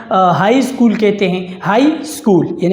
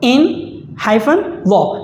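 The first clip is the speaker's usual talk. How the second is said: slow spelled-out; 3 semitones higher, much slower than usual. Shown as a demonstration. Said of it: "اب mother in law میں mother".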